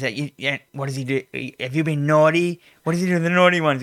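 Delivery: usual speaking voice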